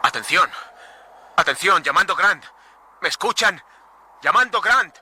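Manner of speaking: high, loud calls over the intercom; calling out. Acted. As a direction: shout into the radio for Grant.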